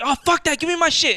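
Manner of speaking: high-pitched mimicked outrage; retold angry demand. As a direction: act out an irate customer demanding things back. Retells customer demanding belongings.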